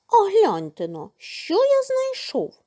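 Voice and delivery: excited rooster, high on the find; proud rooster showing off his find. A narrator's reading. Rooster shows the mice his wheat ear, excited high voice.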